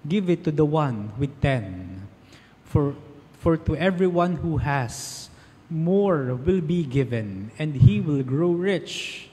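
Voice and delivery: slow, solemn scripture proclamation; gospel reading. Acted. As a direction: read the Gospel aloud at Mass. (solemn na pagbasa ng Ebanghelyo)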